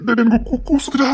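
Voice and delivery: bashful, rising to a high squeal on கூசுதுடா; mock-coy girl voice; coy shy girl voice. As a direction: play a shy girl, coyly. Repeats shy girl bit.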